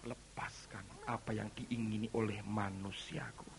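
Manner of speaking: low, hushed, solemn resolve; quiet surrender. Acted. Believer realising he must let go, quiet surrender. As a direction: let it sink down softly, as to yourself.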